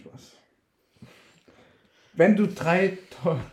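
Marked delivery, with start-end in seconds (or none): 2.1-3.4 loud burst, higher; shouting. Real, not acted. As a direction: blurt the question out loud. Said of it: "Du brauchst nicht zu schreien".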